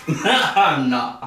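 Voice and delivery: laughing it off; laughing denial. Real, not acted. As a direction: deny it with a laugh. Accused of looking high, laughs.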